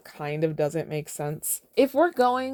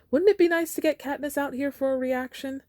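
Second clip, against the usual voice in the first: high, bright TV-host voice; showman host voice; 11 semitones higher than usual. Acted. Caesar working the crowd, hammy host voice. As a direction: play the grinning showman.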